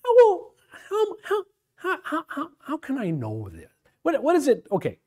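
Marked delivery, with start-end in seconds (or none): 0.1-3.7 hammed-up stammering how, how, high and laughing, then fading; mock flustered doubter. Teacher mocks a doubter stammering to God. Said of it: "saying to God".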